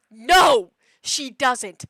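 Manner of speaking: sudden very loud outburst; exasperated shout. Retold or imitated. Owner fed up that cat won't relax, exasperated shout. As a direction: burst out with it.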